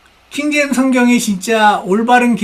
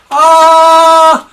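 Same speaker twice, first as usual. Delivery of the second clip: a loud, drawn-out, higher "ah" to drown out words; loud long ah; 8 semitones higher, 11 dB louder than usual. Shown as a demonstration. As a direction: hold a loud "ah" so you hear nothing. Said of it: "하면서".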